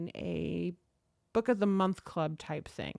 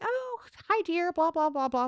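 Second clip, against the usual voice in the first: put-on much higher, sugary old-lady greeting; lightly mimicking chirpy grandmas; sweet old ladies; 8 semitones higher than usual. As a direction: coo it like a sweet elderly lady. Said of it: "They're like".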